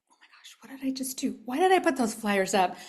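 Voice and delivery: mock-panicked whisper to oneself; retold panic. Speaker recalls dreading her first circle, retold panic.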